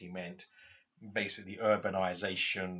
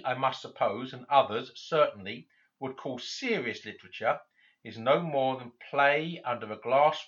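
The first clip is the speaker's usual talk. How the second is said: raised, louder reading voice for the quoted passage; reading a quotation aloud; 3 semitones higher, 6 dB louder than usual. Acted. Lecturer reading Tolkien's essay aloud to students, raised and louder. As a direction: declaim the author's words to the class.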